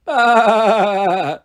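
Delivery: long wobbling imitated baa; mock sheep bleat. A narrator's reading. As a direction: bleat mockingly, drawn out. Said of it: "imitating him".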